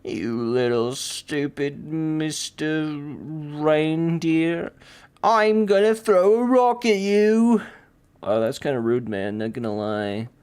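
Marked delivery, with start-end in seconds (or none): 0.0-7.6 slow, higher voice building to loud; mock threat. Character threatens to throw a rock at a reindeer, mock angry. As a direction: start drawn-out, then burst into a high pretend threat.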